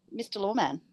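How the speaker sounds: usual speaking voice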